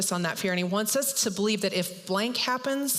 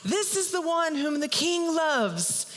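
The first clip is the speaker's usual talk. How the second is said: mock yelled proclamation; 8 semitones higher than usual. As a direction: proclaim it as if to the whole town. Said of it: "and yell out".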